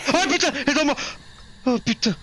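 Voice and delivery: panicked high shriek; scared horror game streamer. Real, not acted. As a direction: scream in terror as it grabs you. Ghost attack, panic. (cri de panique)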